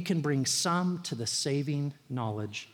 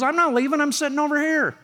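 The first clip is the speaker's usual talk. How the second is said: louder, higher acted customer outburst; irritated retort; 9 semitones higher, 6 dB louder, faster than usual. Rude customer answering the manager's goodbye, irritated and loud. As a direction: snap back loudly at a well-wisher.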